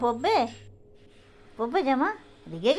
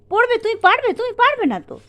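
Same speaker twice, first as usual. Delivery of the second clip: much higher and louder, bright encouragement; excited coaxing; 12 semitones higher, 7 dB louder, faster than usual. Real, not acted. Parent to a toddler refusing her dress, high excited coaxing. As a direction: pump her up so she lets you dress her.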